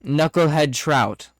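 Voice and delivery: loud, rising disbelief, slowing down; incredulous. Real, not acted. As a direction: repeat the order in shocked disbelief. GM incredulous at absurd order.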